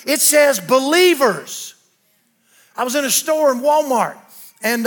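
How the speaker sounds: loud, high, emphatic pulpit delivery; full-force preaching. Real, not acted. Preacher proclaims God's healing power through Paul, full-force preaching.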